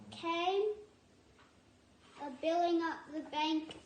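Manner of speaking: ordinary delivery, nothing marked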